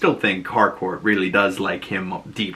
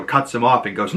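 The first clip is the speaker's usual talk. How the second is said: usual speaking voice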